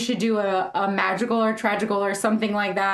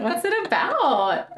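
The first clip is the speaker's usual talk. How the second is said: mock curious; 9 semitones higher, slower than usual. Mock interviewer question.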